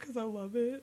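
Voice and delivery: small, soft mock-sheepish admission; sheepish confession. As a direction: imitate yourself owning up meekly. Sheepish confession to baffled friends.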